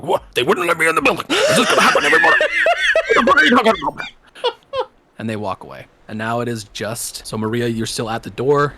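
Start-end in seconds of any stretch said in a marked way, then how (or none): 0.0-4.1 higher and louder, laughing protest; incredulous friend shouting to everybody; outraged friend. Retold or imitated. Friend complaining loudly he was kept out, outraged laughing. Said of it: "He's like".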